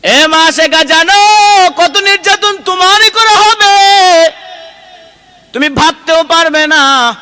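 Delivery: high, loud sermon cry; impassioned waz delivery with stretched endings; full-force preaching. Acted.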